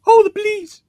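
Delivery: very high, loud hammed-up exclamation; high mock cry. Roy being carried when police come, high mock cry.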